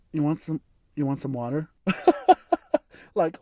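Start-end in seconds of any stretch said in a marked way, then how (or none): 0.1-1.7 soft, hesitant offer; tentative, hushed question. Retold or imitated. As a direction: offer it quietly and awkwardly. Offering water to crying woman.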